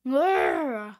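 rolling comic snarl; villain's growl. Acted. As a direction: growl in mock menace.

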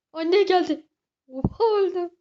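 high, very loud startled shriek; real scream. Real, not acted. Kid jump-scared by the game mom, real scream. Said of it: "Ama bağırmadım".

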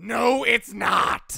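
slow, insistent comic shout; emphatic denial. Acted. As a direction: stretch every word of the denial. Insists against co-host's claim.

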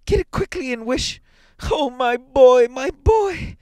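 high, loud urging rising to a wail; desperate mother's high cry; panting, frantic plea. A narrator's reading. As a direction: plead breathlessly, then wail for the boy. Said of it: "she panted".

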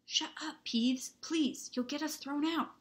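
high, hushed urgent begging; urgent plea. A narrator's reading. Student begging Peeves not to give them away, urgent plea.

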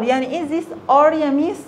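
usual speaking voice

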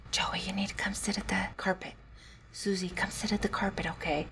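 soft unvoiced whisper to the kids; quiet classroom whisper. Shown as a demonstration. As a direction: whisper the instructions gently. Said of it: "I would actually get quieter".

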